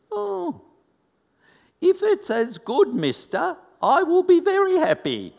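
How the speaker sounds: put-on very high, loud Italian-accented exclamation. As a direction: imitate an Italian lady crying out happily. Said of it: "with an Italian accent".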